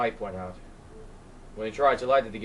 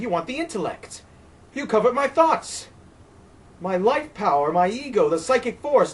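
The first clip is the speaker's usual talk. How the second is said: higher, louder desperate tirade; Colt, frightened and raging; 7 semitones higher, 8 dB louder than usual. Acted. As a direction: accuse the wind in wild fear. Colt's long tirade at the wind.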